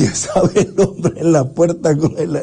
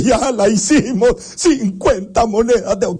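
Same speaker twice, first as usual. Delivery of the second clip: excited youth; 6 semitones higher than usual. Acted.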